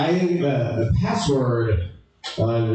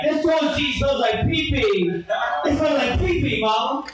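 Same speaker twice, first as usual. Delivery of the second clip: high-pitched whiny complaining, half shouted; whiny guy voice; 14 semitones higher than usual. Retold or imitated. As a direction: whine it like a grossed-out manchild calling his mom. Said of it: "like a guy going down the toilet like".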